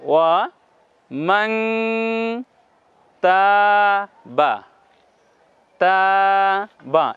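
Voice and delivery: ওয়া মাং তা বা said syllable by syllable, মাং nasal; slow syllable demo. Shown as a demonstration. Tutor modelling recitation syllable by syllable. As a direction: spell it out slowly with a nasal মাং. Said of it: "হ্যাঁ, এভাবে বলুন".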